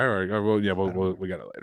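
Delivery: ordinary delivery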